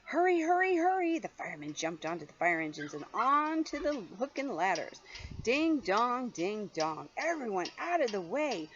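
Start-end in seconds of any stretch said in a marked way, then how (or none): 0.1-1.2 high, loud hurried call; urgent shout. Acted. Firemen shouting to clear the way, loud and high. 5.4-7.1 bell sound. Acted. Fire bell sound in story. 7.2-8.7 urgent shout. Acted. Firemen clearing the way.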